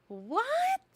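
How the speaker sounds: high shocked "What?". Real, not acted. Host reacting to the haircut on the live, shocked. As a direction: squeal "What?" in disbelief.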